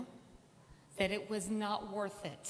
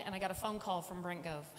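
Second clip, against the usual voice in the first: ordinary talk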